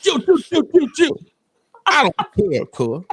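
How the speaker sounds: high mock party voice; imitating Trevor lit. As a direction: call chill up high like a tipsy friend. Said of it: "then you be like this".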